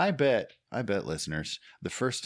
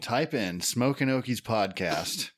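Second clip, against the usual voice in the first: slow instruction; 3 semitones higher, slower than usual. Acted.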